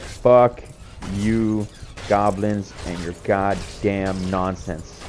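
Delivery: slow, punched-out words with pauses; emphatic retold taunt, dragging to the end; triumphant curse. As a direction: curse the goblins one word at a time.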